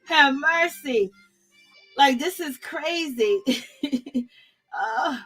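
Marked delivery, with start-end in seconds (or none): 0.0-1.1 slow, drawn-out "Lord, have mercy"; exasperated prayer. Retold or imitated. Host reacting to reality show drama, slow and exasperated. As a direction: sigh it out to heaven. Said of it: "I'm like".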